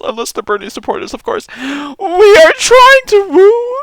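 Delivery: fake-cry it like a wounded liberal; mock crying. Mocking a teary commenter begging for unity, fake sobbing.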